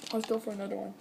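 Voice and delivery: normal talking voice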